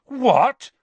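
shocked high gasp. Acted. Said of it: "Harry gasped".

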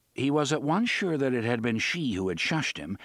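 usual speaking voice

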